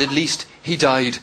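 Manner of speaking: low, slow, lightly acted condolence; mock solemn consolation. Irish pubgoer consoling an Australian, mock solemn. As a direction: console with slow, measured gravity.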